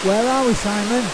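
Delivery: loud documentary presenter. Acted. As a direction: announce it like a TV guide. Presents to camera outdoors.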